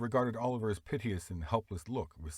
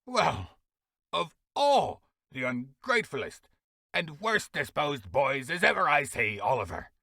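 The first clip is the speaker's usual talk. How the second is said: loud, higher, slow angry rant; berating; 6 semitones higher, 7 dB louder, slower than usual. Acted. Beadle berating the ungrateful boy, angry. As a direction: scold him, drawing it out.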